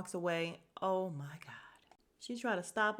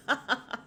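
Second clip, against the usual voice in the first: short amused laugh; amused chuckle mid-story; 4 semitones higher, 4 dB louder than usual. Real, not acted. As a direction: break into a quick laugh. Laughs at her own retelling.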